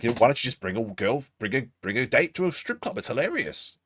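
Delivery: lightly mocking put-on voice; mimicking dating adviser. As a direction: parody a man's bad dating advice. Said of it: "He's like".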